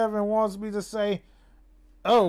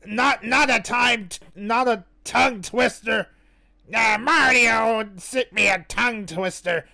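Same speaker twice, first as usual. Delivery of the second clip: halting tongue twister in a cartoon villain voice; forceful put-on voice, word by word; hammed-up character voice, labored; Wario. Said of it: "tongue twisters with that voice".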